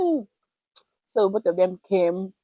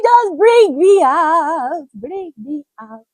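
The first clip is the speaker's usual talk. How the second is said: pleading rescue; 9 semitones higher, 10 dB louder, much slower than usual. Retold or imitated. Begging rescuers to pull her.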